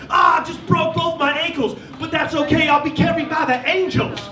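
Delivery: high, laughing rap-battle delivery; mock freestyle rapper; rapper quote. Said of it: "He was like".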